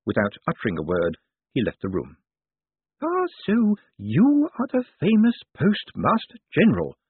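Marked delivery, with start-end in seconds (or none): 3.0-7.0 put-on high old lady voice, appraising; Missus Lavish. A narrator's reading. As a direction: size up a newcomer as a feisty elderly lady. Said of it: "said Missus Lavish".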